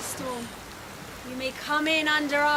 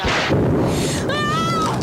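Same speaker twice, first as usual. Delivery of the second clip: sudden piercing scream, much higher and louder; terrified scream; 14 semitones higher, 7 dB louder than usual. Acted. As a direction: jolt awake screaming from a nightmare. Wakes screaming from nightmare.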